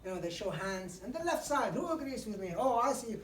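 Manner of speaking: high, loud, bright call to the crowd; lively audience call. Shown as a demonstration. Speaker calling out to wake up a dull audience, loud and high. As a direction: rouse the room with energy. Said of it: "Then quickly you say".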